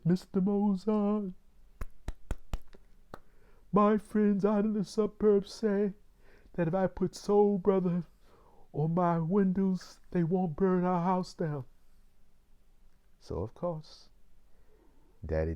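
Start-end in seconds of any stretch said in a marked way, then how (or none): 0.1-11.7 imitating the neighbor in a high, loud voice; German immigrant neighbor. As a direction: call out high and loud as the immigrant next door. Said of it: "a German immigrant next door neighbor".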